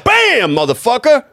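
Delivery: hammed-up card-table shout; mock gambler cry. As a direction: belt it out like a gambler slamming a card down.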